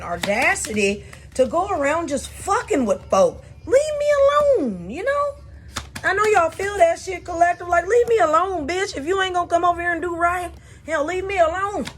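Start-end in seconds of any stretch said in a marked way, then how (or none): 3.7-5.3 high, pleading leave me alone; begging to be left alone; a real cry of fed-up frustration; exasperated cry. Real, not acted. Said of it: "agitated, irritated, and sort of pissed off". 6.0-11.9 fed up. Real, not acted. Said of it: "agitated, irritated, and sort of pissed off".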